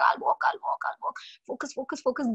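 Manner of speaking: lightly voiced toddler muttering; a hint of a toddler egging itself on. Mother imitating a toddler learning to walk. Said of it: "A little toddler is like".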